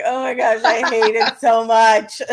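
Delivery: groaning through a laugh; laughing complaint. Retold or imitated. Retelling the kid's complaint, laughing. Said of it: "He was like".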